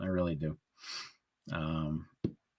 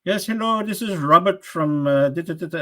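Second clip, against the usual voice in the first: lightly imitating an Indian scam caller; a hint of a phone scammer greeting; mock scam caller; 9 semitones higher, 3 dB louder than usual. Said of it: "Indian accent will come on and say".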